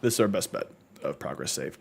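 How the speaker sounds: ordinary talk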